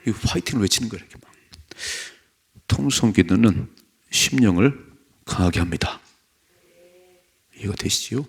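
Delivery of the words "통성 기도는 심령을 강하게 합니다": ordinary delivery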